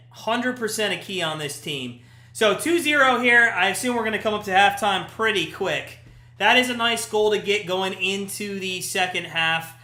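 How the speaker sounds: ordinary talk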